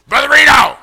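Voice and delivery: loud, high raspy shout in imitation; Ryan, real raspy. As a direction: imitate a raspy teenager hollering. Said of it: "He real raspy".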